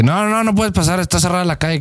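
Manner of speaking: high-voiced scolding woman. Voicing women blocking the street.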